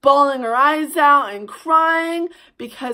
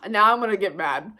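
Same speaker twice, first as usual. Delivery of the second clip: usual speaking voice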